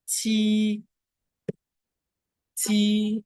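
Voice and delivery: "ti" high, long and level; sustained, unbending high "ti"; first tone. Shown as a demonstration. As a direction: hold "ti" on one high note. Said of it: "le ton constant, le premier ton".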